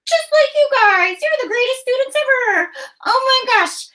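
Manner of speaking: very high, squeaky puppet-like voice; Franny. Pretend. Excited sidekick praises the students, in a high put-on voice. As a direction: gush in a high-pitched character voice. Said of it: "Franny, you are so right".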